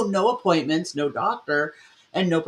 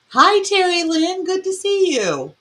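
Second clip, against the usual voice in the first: excited greeting; 11 semitones higher, 4 dB louder than usual. Real, not acted. Greeting a chat viewer.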